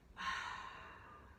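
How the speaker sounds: long breathy sigh out through the mouth; audible exhale sigh. Shown as a demonstration. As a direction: let the breath go in a slow sigh. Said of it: "The three-part yogic breath".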